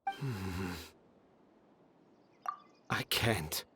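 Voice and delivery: low, soft, defeated; dejected. Acted. Author admitting defeat to friends, low and defeated. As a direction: give up quietly.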